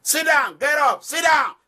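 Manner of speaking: guard yelling at inmates to sit; prison guard commands. Shown as a demonstration. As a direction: shout them down like a jail guard. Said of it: "I shout all day".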